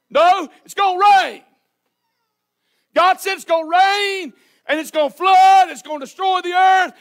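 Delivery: loud, high, fervent insistence; insistent Noah. Acted. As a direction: preach it back at the mockers. Noah defends the ark loudly.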